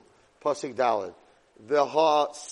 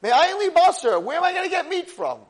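high, loud exasperated outcry; unraveling; 12 semitones higher, 8 dB louder than usual. Retold or imitated. Moshe asked for meat, unraveling in loud exasperation. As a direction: cry it out as if coming apart. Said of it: "He seems to be unraveling".

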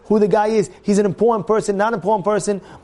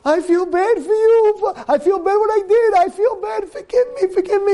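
desperate apology; 10 semitones higher than usual. Retold or imitated. Owner begging the rabbi he wronged, hammed-up pleading. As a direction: beg for mercy in a hammed-up high wail.

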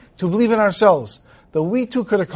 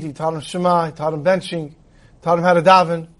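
usual speaking voice

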